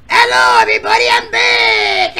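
put-on high, loud old woman voice shouting; shrill granny shout. Granny contestant greeting the audience with a loud high shout. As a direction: yell it like a cranky granny.

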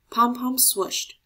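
ordinary delivery, nothing marked

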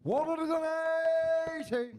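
high, loud one-eighty call; darts caller. Real, not acted. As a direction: belt out the score. Darts caller announces maximum.

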